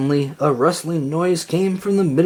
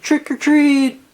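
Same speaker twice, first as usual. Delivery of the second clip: much higher, slow singsong call; trick or treat call; 11 semitones higher, slower than usual. A narrator's reading. Kids at each door, singsong call. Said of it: "everyone cried".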